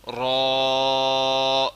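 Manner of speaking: را said thick and full; heavy ra. Shown as a demonstration. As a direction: make را heavy and round. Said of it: "را کو موٹا پڑھنا ہے".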